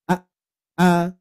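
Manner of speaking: each "A" started with a glottal catch and cut short; throat-stopped A. Shown as a demonstration. Teacher drilling the hamzah A for parents. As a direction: repeat "A" with a hard stop in the throat each time. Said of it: "syiddah itu betul-betul".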